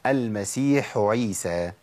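المسيح عيسى, a breathy ح kept apart from the ع; slow careful المسيح عيسى; clear ha before ain. Shown as a demonstration. Teacher modelling the correct recitation. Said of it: "وأقول".

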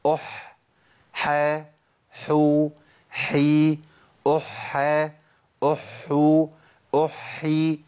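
slow Tajweed drill from أح to أحي, vowels drawn out; measured articulation of every ح; careful letter drill. Shown as a demonstration. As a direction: recite each form of ح in turn, evenly held. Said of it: "هقولهم ورا بعض".

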